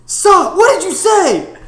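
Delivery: high, loud, drawn-out imitation of a friend's outburst; mock outraged shout. Imitating friend reacting to a bad accent, high outraged shouting. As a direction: yell it high in exaggerated disbelief. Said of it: "Cam just was like".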